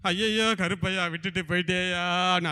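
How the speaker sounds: imitated keening on a held note; mock funeral wailing. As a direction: wail for the dead, hammed-up. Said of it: "நீங்க போய் என்ன அழுவீங்க".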